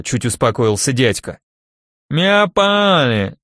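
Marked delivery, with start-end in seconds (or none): 2.1-3.4 slow, high, garbled through a mouthful of cutlet; mumbled with mouth full. A narrator's reading. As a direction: chew the words, barely intelligible. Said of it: "невнятно выдал этот гад". (невнятно, с набитым ртом)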